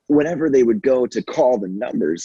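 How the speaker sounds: ordinary talk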